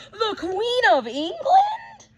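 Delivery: slow, high, loud amazement; amazed exclaim. Acted. Child spotting the Queen at the window, amazed. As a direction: stretch it out in wonder.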